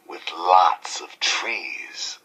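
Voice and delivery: slow, hushed whisper; secret whisper. Acted. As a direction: lean in and whisper the secret slowly. DJ shares a secret rumour.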